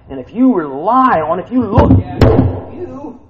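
building fervent preaching; excited. Real, not acted. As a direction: let the excitement run over. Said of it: "I get a little excited sometimes".

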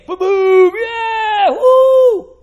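high, loud whooping booms; booming waves. Acted. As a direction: shout the crash, then whoop. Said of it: "like the boom of many pounding waves".

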